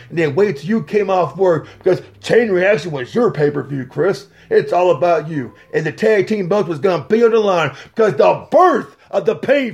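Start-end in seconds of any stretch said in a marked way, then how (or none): none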